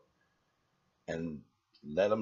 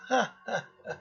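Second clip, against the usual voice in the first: mock laugh; 11 semitones higher, faster than usual. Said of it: "We go".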